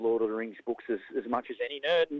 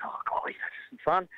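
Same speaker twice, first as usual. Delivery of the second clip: breathy half-whispered mock dismay, pitched up; 6 semitones higher than usual. Recalling divorce court, a half-whispered mock dismay. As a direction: whisper it like a wry aside. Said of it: "I'm like".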